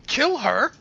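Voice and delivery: loud, high-pitched battle command; barked order. Acted. Enemy fighter ordering the others to attack, barked order. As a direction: bark it out sharply, pitched well up. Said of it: "basically barks an order".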